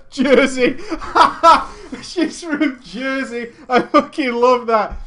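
loud, high laughing delight; cracking up. Real, not acted. Streamer cracking up at a viewer's guess, laughing. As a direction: laugh through it, thrilled.

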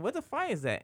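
alarmed, pitch shooting up high; high shocked question. Retold or imitated. Friend recalling the smoky street, high shocked question. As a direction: gasp it out in high disbelief. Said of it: "I'm like".

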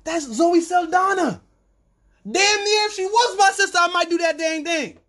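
climbing high and loud in disbelief; incredulous protest. Acted. To the alien owners about Zoe, a high outraged squeal. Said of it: "you're sitting here going".

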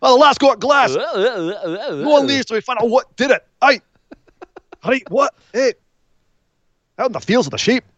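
hammed-up, hard-to-follow Glaswegian accent; Scottish accent. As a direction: imitate baffling Glaswegian relatives in broad Scots. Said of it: "the Scottish accent".

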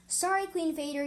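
loud call from above. Acted. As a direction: call down from the treetops. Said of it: "yelled a familiar voice".

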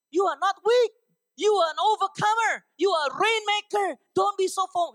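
high, loud motivational declarations; fired-up preacher's rally cry; rallying shout. Real, not acted. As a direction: shout it like a pep talk, pitch way up.

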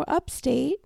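high-pitched mimicry of other people; mimicked upstaters. Imitating people who claim to be New Yorkers, high-pitched. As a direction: answer in their put-on high voice. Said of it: "And they go".

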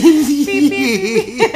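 loud laughing bleep sounds; censor beep. Pretend. Bleeping out a name.

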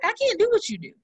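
retold employee.

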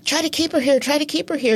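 insistent repeated urging, voice pushed up high; urgent prodding. Retold or imitated. Producer hissing at the actor to keep her there, urging.

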